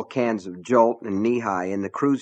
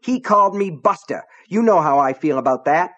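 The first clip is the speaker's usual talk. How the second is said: higher, louder aggrieved blurt; higher, louder angry outburst; 6 semitones higher, 6 dB louder than usual. A narrator's reading. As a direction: burst out with wounded fury. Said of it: "and then burst out".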